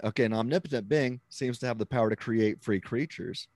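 ordinary talk

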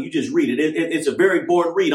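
ordinary delivery

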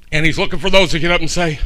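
ordinary talk

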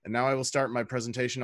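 ordinary delivery

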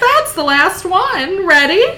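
put-on piping, loud, drawn-out Capitol accent; very high and hammed-up Capitol accent, rising to the question; silly Capitol accent. A narrator's reading. Venia cheering on her waxing client, silly high Capitol accent. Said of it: "speak in such a high pitch".

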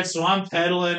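ordinary talk

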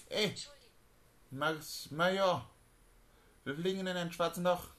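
high alarmed yelling crew member; panicked shout. Acted. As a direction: yell the warning up loud.

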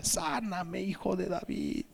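soft, high, drawn-out plea; hushed imitation of a crowd's pleading call; mock distant cry. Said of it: "la gente estaba enferma y gritaba".

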